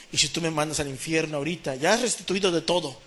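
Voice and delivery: normal talking voice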